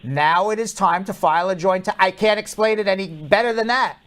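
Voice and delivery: exasperated lecture. Real, not acted. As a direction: scold the caller, fed up.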